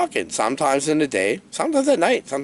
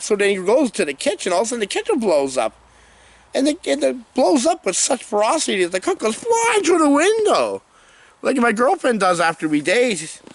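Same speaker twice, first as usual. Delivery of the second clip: normal talking voice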